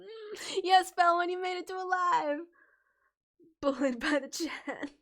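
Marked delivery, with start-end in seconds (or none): none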